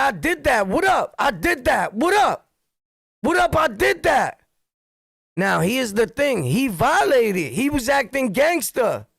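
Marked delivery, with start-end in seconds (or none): 0.0-4.4 defiant shout. Retold or imitated. Facing the crowd in the store, defiant shouting. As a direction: yell it back, daring anyone to step up. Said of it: "I said".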